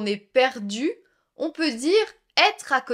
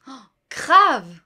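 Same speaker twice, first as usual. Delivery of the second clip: enthusiastic agreement; 8 dB louder than usual. Acted.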